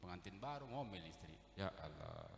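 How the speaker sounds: ordinary delivery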